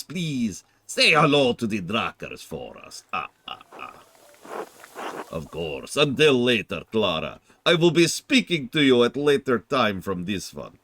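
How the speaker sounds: a hammed-up German accent. Streamer voicing a villager in a German accent. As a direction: play the German farewell with a thick put-on German accent. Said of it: "I gotta do German".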